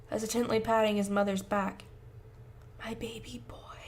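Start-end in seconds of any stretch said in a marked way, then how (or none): 2.8-3.8 unvoiced tender whisper. Mother whispering to her son while hugging him. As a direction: breathe it into his hair. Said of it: "she whispers".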